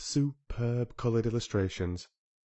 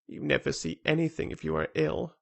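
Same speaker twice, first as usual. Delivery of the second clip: restless; 6 semitones higher than usual. A narrator's reading. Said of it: "said Colin restlessly".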